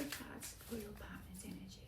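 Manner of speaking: softly whispered to herself. Real, not acted. Tarot reader calling the cards for a viewer, whispering. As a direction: breathe the words, no voice.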